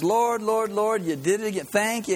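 high, fervent praise; joyful grandma praise. Retold or imitated. Grandma thanking God at dawn, high fervent praise. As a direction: call out to the Lord with joy.

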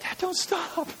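very high, whiny mock-child voice; pleading kids. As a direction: imitate kids begging their dad. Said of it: "my kids would be like".

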